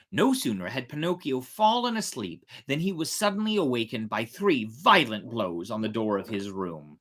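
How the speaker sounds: ordinary delivery, nothing marked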